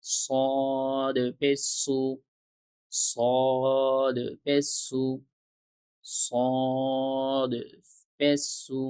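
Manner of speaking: very slow, each syllable drawn out like a chant; slow recitation drill. Shown as a demonstration. Teacher reciting Arabic letters slowly for students to repeat. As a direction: stretch every letter name slowly for the learners.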